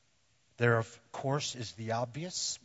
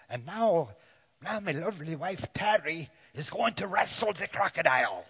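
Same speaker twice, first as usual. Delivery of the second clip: loud, higher-pitched mock Australian TV host; botched Australian accent; 4 semitones higher, 8 dB louder than usual. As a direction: attempt an Aussie crocodile hunter, slipping towards Irish. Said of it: "rich Australian accent, would say".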